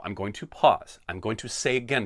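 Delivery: normal talking voice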